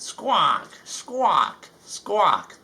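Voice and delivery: high cawing squawks; crow squawks. Acted. Crows squawking in the story.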